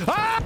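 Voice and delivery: short high yelp; startled scream. Real, not acted. Gamer hit mid-game, startled scream. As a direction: let out a quick, high scream. Startled yell during gameplay.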